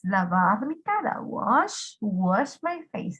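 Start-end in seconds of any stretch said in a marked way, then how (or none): 1.2-3.2 "Wash" said alone with a rise, then "wash my face" slowly; teacher modeling pronunciation. Shown as a demonstration. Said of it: "¿Escucharon la pronunciación?".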